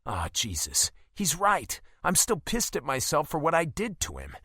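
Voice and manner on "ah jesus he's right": ordinary delivery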